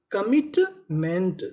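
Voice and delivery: Commitment drawn out slowly; slow syllable by syllable. Shown as a demonstration. Teacher to students, sounding out the word slowly. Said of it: "तो पूरी meaning".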